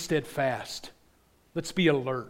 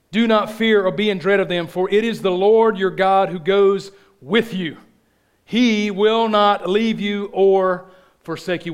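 preaching at full force; 5 semitones higher, 9 dB louder than usual. Acted. Pastor proclaiming scripture to his church, loud ringing preaching. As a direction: proclaim it like a battle cry.

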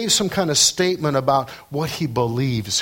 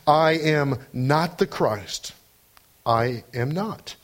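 slow, fading I am not the Christ, then I am not; slow and shortening; slower than usual. Shown as a demonstration. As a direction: let each answer shrink. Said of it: "it becomes shorter in his response".

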